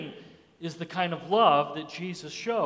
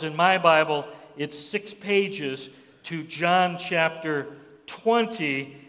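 ordinary talk

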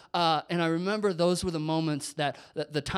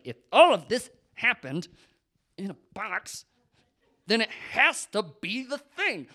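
exasperated arguing; 7 semitones higher, 8 dB louder than usual. Retold or imitated. Retold exasperated argument to pastor.

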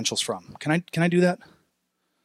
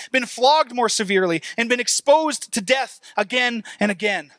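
raised pitch, driving preacher's reading; impassioned scripture recital; 5 semitones higher than usual. Acted. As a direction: build a list of hardships, phrase after phrase. Recites Paul's list of hardships.

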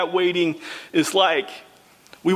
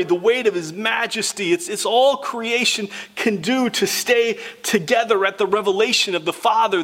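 ordinary delivery

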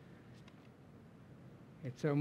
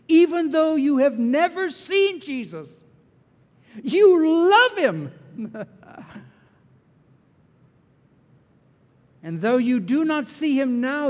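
much higher and louder, slow, overflowing joy; ecstatic scripture reading; 7 semitones higher, 9 dB louder than usual. Acted. Pastor reading Peter's letter to believers, ecstatic and slow. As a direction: let the joy spill over, laughing on trust in him.

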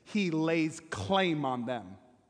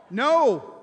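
a sudden loud, high "No!"; playful shout; 6 semitones higher, 10 dB louder than usual. Real, not acted. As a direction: bark it out as a playful jab. Teasing the congregation's ignorance.

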